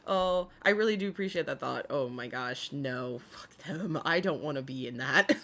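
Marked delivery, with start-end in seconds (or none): none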